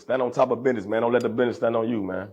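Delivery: usual speaking voice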